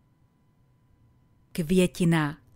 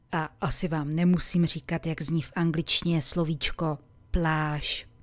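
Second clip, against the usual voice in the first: usual speaking voice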